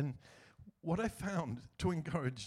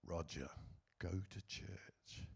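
soft, slow, lower inner voice; little inner voice; 8 semitones lower, 8 dB quieter, slower than usual. Retold or imitated. A little voice telling Roger to go to church, soft and slow. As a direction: let it drift in softly and slowly, like a voice in your head. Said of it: "a little voice said to me".

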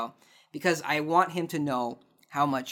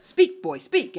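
much higher, louder call to a dog; dog command; 9 semitones higher, 5 dB louder, slower than usual. Retold or imitated. Owner calling to a dog to speak, bright command. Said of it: "when you say, like".

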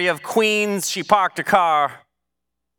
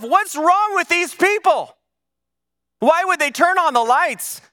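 indignant questioning; 10 semitones higher than usual. Acted. Preacher voicing listeners' reaction to idle witnesses, indignant questioning. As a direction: voice the outrage, pitched way up.

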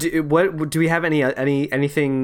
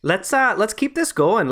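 higher, put-on Minnesota accent; 8 semitones higher than usual. Inviting co-host to critique it, mock Minnesota accent. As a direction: keep up a playful, high Minnesota accent. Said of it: "you can critique my accent".

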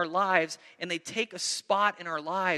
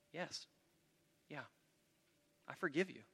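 much softer, hushed and tender; gentle forgiveness; 14 dB quieter than usual. Acted. Joseph forgiving his brothers, soft and tender. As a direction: let it fall soft, almost a whisper.

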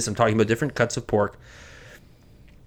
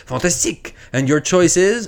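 mock French gun seller; 6 semitones higher than usual. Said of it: "And Gunseller says".

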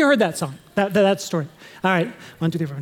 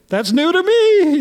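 put-on surprised young listener; 8 semitones higher, 4 dB louder, slower than usual. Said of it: "there's like".

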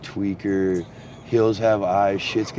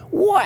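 very high, drawn-out wail; piercing mock cry of pain; imitated animal shriek; 4 dB louder than usual. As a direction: screech like something just got shot. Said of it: "I hear this".

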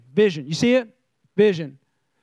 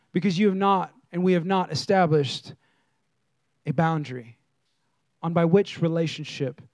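hushed preaching; 8 semitones lower, 4 dB quieter than usual. Acted. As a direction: lean in and preach it quietly. Preacher drops into soft intense delivery.

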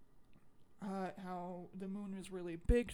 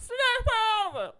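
high, loud [name]-style yell; [name] impression; 10 dB louder than usual. Fan imitating [name]'s slap line, loud yell. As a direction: bark it like [name] in the movie. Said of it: "it was just [name] impressions".

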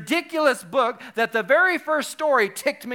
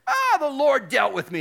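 loud, high, booming exclamation; Bob Goff impression; 6 semitones higher, 5 dB louder than usual. Retelling his church message to the congregation, exuberant loud impression. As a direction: imitate an exuberant speaker, full voice and high. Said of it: "And this is how he talks".

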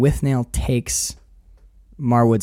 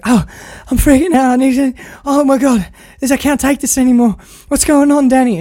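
high-pitched mock panic; Marwood freaking out; 11 semitones higher, 5 dB louder than usual. Acting out Marwood begging Danny for answers, mock panic. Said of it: "when Marwood starts to freak out".

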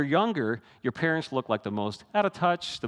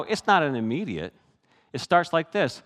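ordinary talk

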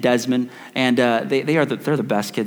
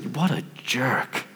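muttered resentment; 7 dB quieter, much slower than usual. Acted. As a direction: grumble it under the breath, drawn out. Resentful inner reply to a greeting.

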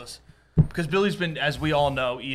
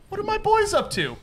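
high-pitched, lilting curiosity; acted-out chirpy greeting to the guys; playful check-in; 14 semitones higher than usual. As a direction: pop in with a high singsong question. Acting out cheerful check-in on friends.

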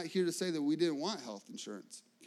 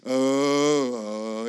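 groan; 4 semitones lower, 8 dB louder than usual. Retold or imitated. Trudging in to do taxes.

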